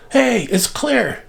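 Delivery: high, slow, drawn-out call; mock excited call. Child tells mother the picture cleared, mock excited call. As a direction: imitate calling good news from indoors. Child calls out the good news.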